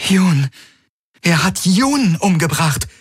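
hohe, entsetzte Erkenntnis; entsetzt. A narrator's reading. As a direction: die schlimme Nachricht fassungslos begreifen. Kirai erfährt entsetzt von Juns Tod. (entsetzt, fassungslos)

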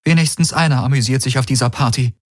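high, quick, hurt remark; toneless with a tremor. A narrator's reading. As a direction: cover the hurt with a quick jab. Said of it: "Ihre Stimme klang tonlos, aber er hörte das Beben darin".

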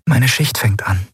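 ordinary delivery

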